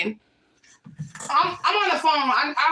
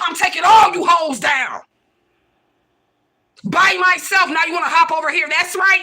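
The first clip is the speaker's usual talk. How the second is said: real angry shout; 2 semitones higher, 6 dB louder than usual. Real, not acted. Furious rant at former ally.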